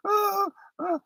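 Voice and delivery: very high, whimpering falsetto sobs; mock sobbing. Declining the Egyptian guys' drink, in high whimpering sobs. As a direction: whimper like a crying child. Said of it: "so I'm like".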